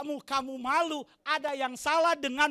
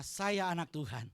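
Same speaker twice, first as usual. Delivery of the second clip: ordinary talk, nothing marked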